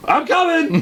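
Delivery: high mock holler; retold calling out. Friend crashing toward the hunter, high imitated holler. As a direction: holler that you are on the way.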